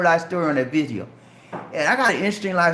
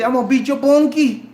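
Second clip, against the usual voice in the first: higher, louder Geechee threat in jest; Geechee accent; 6 semitones higher, 5 dB louder than usual. Retold or imitated. Repeating a childhood threat for laughs, loud Geechee accent. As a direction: playfully hammer out the Geechee accent. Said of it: "we had a bad accent".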